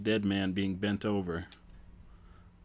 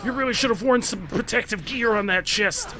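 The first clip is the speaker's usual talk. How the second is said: silly clown; 9 semitones higher, 8 dB louder than usual. Pretend. Wants you to honk your horn.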